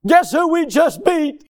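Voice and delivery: high, loud victorious shout; elated coach yelling at his boys; triumphant coach. Retold or imitated. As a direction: crow about the win to the team. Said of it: "he was saying".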